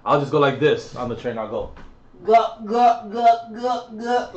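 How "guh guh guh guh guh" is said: high, put-on gagging grunts; mock gagging noises. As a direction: ham up a mock gag. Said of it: "like that".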